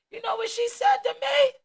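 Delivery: high, loud outraged question; leaning in to hook the crowd before the punchline; indignant buildup. Real, not acted. Telling the audience about her teacher, loud outraged question.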